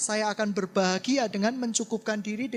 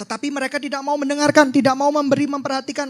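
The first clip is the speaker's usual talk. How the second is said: louder and fast, driving the verse home; forceful reading; 4 semitones higher, 7 dB louder, faster than usual. Shown as a demonstration. Preacher rereading the verse to the congregation, loud and urgent.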